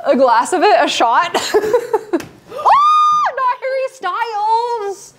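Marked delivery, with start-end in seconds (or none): 2.6-5.1 high, drawn-out alarmed cry; surprised shout. Real, not acted. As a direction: yelp as the figure topples. Cake figure falling over.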